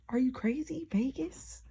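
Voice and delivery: high, whispered disbelief; can't believe what God asks; stunned whisper. Retold or imitated. Questioning Jesus about Vegas in a high, stunned whisper.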